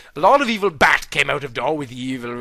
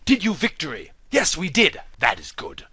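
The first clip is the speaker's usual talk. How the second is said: quiet; 2 semitones lower, 7 dB quieter than usual. Acted. Aragorn's quiet replies.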